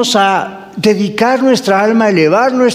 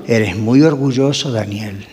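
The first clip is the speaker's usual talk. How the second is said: quiet voice of God; 9 semitones lower, 3 dB quieter than usual. Retold or imitated. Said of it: "Dios me ha dicho a mí".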